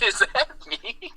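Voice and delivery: high, slow mock disbelief; imitated dismayed grandpa. Grandpa seeing himself on video, imitated dismay. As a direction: draw the question out in a high mimicking voice.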